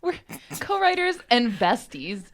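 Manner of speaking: laughing through the correction. Real, not acted. Co-writer correcting her partner with a laugh, laughing.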